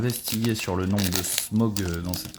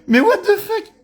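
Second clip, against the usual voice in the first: high, loud disbelieving yell through a laugh; stunned shout; 10 dB louder than usual. Real, not acted. Reacts to foil pull. (cri incrédule aigu)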